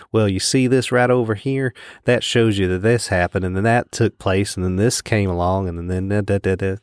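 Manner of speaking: put-on Southern sleuth's accent; Southern detective. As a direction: lay out the evidence like a Southern detective. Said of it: "And the Southern comes up".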